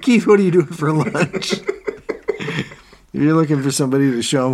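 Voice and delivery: high, loud, laughing through the words; real laughter breaking into the quote; cracking up. Real, not acted. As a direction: barely get the question out for laughing. Cracking up while quoting the boss.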